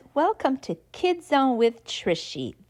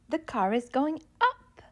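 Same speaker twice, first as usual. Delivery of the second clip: going up; 5 dB quieter, faster than usual. Shown as a demonstration. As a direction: voice climbs on up.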